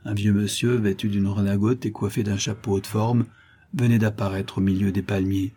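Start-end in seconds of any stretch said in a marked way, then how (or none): none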